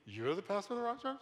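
high, rising disbelief; incredulous question. Retold or imitated. Stranger reacting to the pastor's introduction, incredulous. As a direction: mimic a stranger's doubtful double-take. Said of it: "they will say, without skipping a beat".

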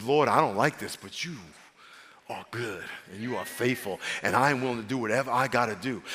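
soft, low, trusting prayer; humble complaint. Shown as a demonstration. Preacher showing God's way to complain, soft and low. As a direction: complain gently but trust him. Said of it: "Complaining God's way is".

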